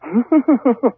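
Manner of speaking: high burst of laughter; laughing. Real, not acted. Amused host reacting to co-host's joke, laughing.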